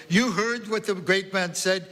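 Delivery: high, emphatic Central European scolding; Central European accent. A narrator's reading. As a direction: drive it home as a Central European grandmother. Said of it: "in a strong Central European accent".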